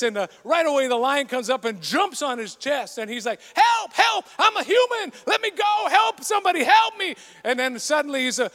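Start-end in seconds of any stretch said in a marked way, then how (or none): 3.5-7.1 high, loud panicked cries for help; panicked shout. Acted. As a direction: scream for help, terrified. Lion attacks man in story.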